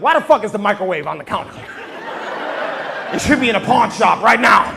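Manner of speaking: loud, high furious rant about the microwave; mad yelling. Retold or imitated. Crackhead yells at the microwave on the counter, furious. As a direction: yell at the kitchen, fed up. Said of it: "I'll be like".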